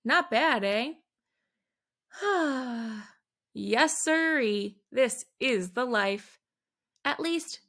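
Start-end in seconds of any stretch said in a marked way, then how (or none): 2.2-3.0 long relaxed sigh of comfort; a happy, drawn-out sigh; contented sigh. Acted. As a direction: sink into the couch with a sigh.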